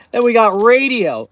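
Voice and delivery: ordinary delivery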